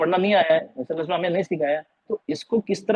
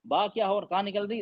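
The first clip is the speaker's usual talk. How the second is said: ordinary delivery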